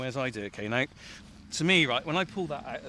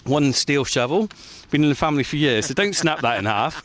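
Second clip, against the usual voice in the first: loud; 2 semitones higher, 8 dB louder than usual. Real, not acted. Bossing helper with shovel.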